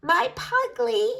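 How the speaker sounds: put-on high, slow, fond old woman; musing old granny. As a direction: ancient granny musing proudly. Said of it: "she mused".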